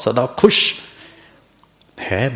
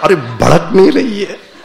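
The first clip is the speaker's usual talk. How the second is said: laughing tease; 7 semitones higher, 7 dB louder than usual. Real, not acted. Teasing the audience mother.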